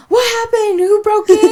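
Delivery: high, loud frightened questions; sister-in-law rushing up, frightened and loud; alarmed sister-in-law. Retold or imitated. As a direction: burst in fearing a break-in. Sister-in-law running upstairs alarmed.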